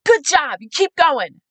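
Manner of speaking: cheering encouragement. Retold or imitated. Supporter urging the tired candidate on, cheering. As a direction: cheer them on like a big fan.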